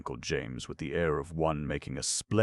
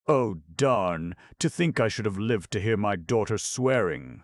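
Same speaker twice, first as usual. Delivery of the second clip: higher, louder, a mother's distraught wail; sobbing; 6 semitones higher, 6 dB louder than usual. A narrator's reading. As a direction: cry it out in shock. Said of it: "sobbed Missus Frederick".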